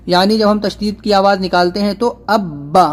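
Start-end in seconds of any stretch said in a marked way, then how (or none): 2.3-2.9 "اب با" with a hard, held double b; firm tashdid. Shown as a demonstration. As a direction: hold the b of "اب با" firmly before releasing. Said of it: "تشدید کی آواز میں ایک قسم کی سختی ہوتی ہے".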